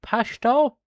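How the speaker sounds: "Pashto" tried out high and loud with a questioning rise; trying to say Pashto. Shown as a demonstration. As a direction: sound out "Pashto" carefully, ending up like a question. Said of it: "how the fuck do you say that?".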